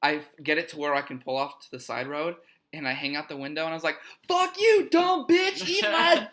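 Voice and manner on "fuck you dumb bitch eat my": high, loud shout with last word drawn out; re-enacted angry yell. Driver yells at the girl while leaving, furious shout. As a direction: holler it full force like yelling from a car window. Yelled out car window, re-enacted.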